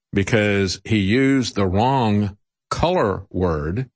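ordinary delivery

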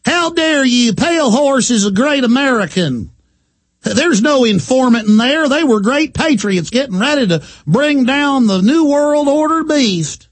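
high, outraged mock patriot voice; mocking defender. Host parodying militia defenders, high outraged mimic. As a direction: ham up the indignant believer.

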